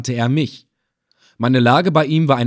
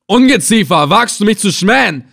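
high, loud, furious insult; outraged cry; 9 semitones higher, 7 dB louder than usual. A narrator's reading. Captor insulted by prisoner kicks him, outraged cry. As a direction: roar at the insolent prisoner. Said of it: "rief er".

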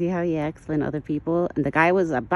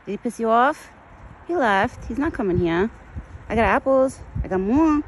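high, sweet voice calming a scared deer; soothing pet talk; 7 semitones higher than usual. Acted. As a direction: coo to the frightened deer.

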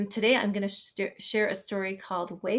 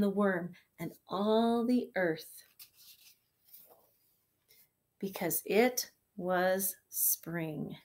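put-on reading to children; 3 dB louder than usual. Reads picture book to kindergarten.

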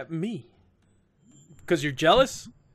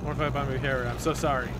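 low, softened apology; subdued apology; 4 semitones lower, 6 dB quieter, faster than usual. Acted. Drunk girl apologizes to grieving friend, low and subdued. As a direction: drop down quietly. Character apologizes for forgetting death.

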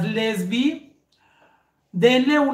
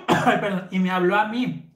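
normal talking voice

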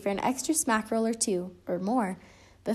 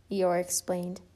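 plain reading voice

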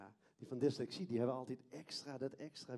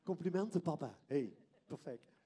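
ordinary talk, nothing marked

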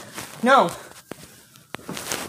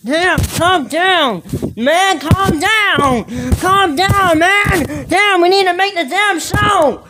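raised, insistent calming yell, drawn out; loud pleading to calm down; 4 semitones higher, 3 dB louder, slower than usual. Acted. Host yelling at castmate to stop fighting, loud. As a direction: shout him down to stop the fight.

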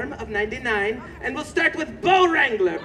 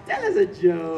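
ordinary delivery

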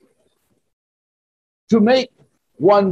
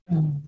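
demonstrated sigh; 7 dB quieter than usual. Shown as a demonstration. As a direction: let out a quiet sigh of distress. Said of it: "That means they continued to go".

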